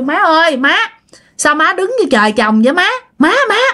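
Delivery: high, repeated calls to mother-in-law; calling to rouse. Acted. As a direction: call her out of her daze, pitch climbing. Daughter-in-law calls the frozen mother-in-law. (gọi má dồn, giọng cao)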